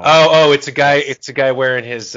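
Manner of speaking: loud burst of sudden understanding, voice jumping up; sudden realization. Real, not acted. Co-host realizing the team name's meaning, loud surprised outburst. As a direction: it clicks and he blurts it out loud. Realizing what Glasgow Clan means.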